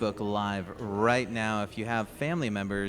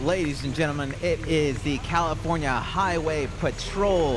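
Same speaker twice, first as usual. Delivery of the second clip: parade announcer introduction; 4 semitones higher, 4 dB louder than usual. Real, not acted. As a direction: announce it to the crowd.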